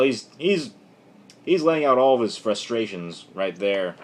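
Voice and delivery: usual speaking voice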